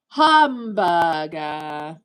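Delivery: slow, drawn-out mock Japanese hamburger; mock Japanese accent. Streamer quizzing chat on a fake Japanese word, slow mock accent. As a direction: stretch hamburger slowly as hahm-bah-gah. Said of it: "here's your Japanese word".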